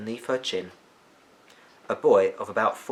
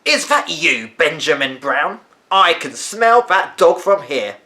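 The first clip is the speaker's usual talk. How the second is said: higher, louder, slow call from upstairs; an old woman calling down to the boy about the dog; stern grandmother calling; 6 semitones higher, 8 dB louder, slower than usual. A narrator's reading. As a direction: call down the stairs, disapproving. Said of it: "a voice called out".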